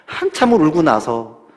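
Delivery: normal talking voice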